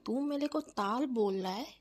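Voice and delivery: lisping little sister, offended; childish lisp. Acted. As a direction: protest with babyish mispronounced consonants. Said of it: "ए तोतली".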